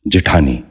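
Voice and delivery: جٹھانی said "ji-thaani", with i after the j; short i in the first syllable of جٹھانی; correct pronunciation. Shown as a demonstration. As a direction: say جٹھانی as "ji-THAA-ni". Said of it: "آپ بھی غور سے سنیے".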